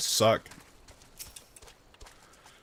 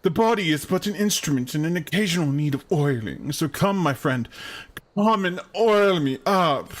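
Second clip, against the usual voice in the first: theatrical character voice; 3 semitones higher than usual. Acted. Companion begs to be oiled up, in a hammy grand voice. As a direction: beckon the friend with pompous relish.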